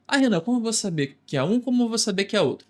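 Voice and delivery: voz de aluno, rápida e mais aguda; aluno em dúvida. Retold or imitated. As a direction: imitando a dúvida do aluno, apressado. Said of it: "aluno imaginário pergunta". (aluno confuso perguntando ao professor)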